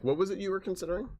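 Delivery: put-on a light German accent, like a calm advisor; hint of a German accent, asking gently. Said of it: "with a nice German accent".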